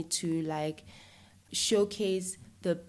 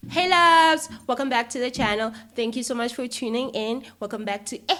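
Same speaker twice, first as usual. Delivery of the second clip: bubbly vlogger greeting, higher and louder; YouTube intro voice; 4 semitones higher, 7 dB louder than usual. Shown as a demonstration. Host asks how she opens her YouTube channel, bubbly vlogger intro. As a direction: hype up your channel viewers. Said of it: "uto-introduce njani? ... athi ukuthi, "Okay, ngo".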